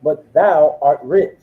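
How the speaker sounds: slow, deliberate verse reading; scripture reading. Acted. Resuming the verse for the preacher, slowly. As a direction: drag each word of the verse out.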